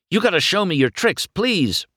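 fast, high, loud begging; excited high-pitched request; eager pleading. A narrator's reading. McQueen begs Doc for tricks.

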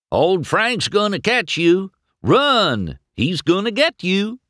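high, excited taunting yell; whooping high-pitched teasing alarm; gleeful holler. A narrator's reading. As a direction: holler a mock warning, enjoying the chase. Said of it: "Mater hollered, laughing his bumper off".

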